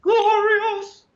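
high, loud, exaggerated "Glorious!"; mock grand echo. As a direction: overblown mock-delight echo of the word. Echoes co-host's word mockingly.

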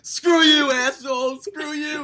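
high, loud, cracking with laughter; laughing mock outrage. Real, not acted. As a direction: yell it while cracking up. Mock outrage at embarrassing story.

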